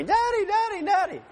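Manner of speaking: put-on very high little-girl voice calling Daddy; imitated daughter calling out. Daughter chasing her dad down the street, high little-girl calling. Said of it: "Adelaide's running after me".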